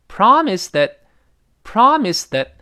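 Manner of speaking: slow demo, that barely there; weak that. Shown as a demonstration. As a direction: promise that, with that reduced. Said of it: "连词要读得非常弱".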